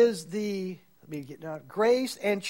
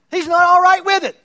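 high and loud, each word hit; loud declaration; 6 semitones higher, 7 dB louder than usual. Real, not acted. Pastor proclaiming to the congregation, loud and emphatic. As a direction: thunder it at the congregation.

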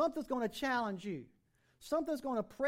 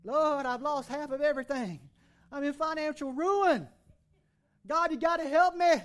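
louder and louder, hammed-up self-pity; a hammed-up pity party, getting louder; mock pity-party wail; 3 semitones higher, 6 dB louder than usual. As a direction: whine it up to a loud plea. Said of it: "pity partying it up".

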